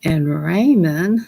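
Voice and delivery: ordinary talk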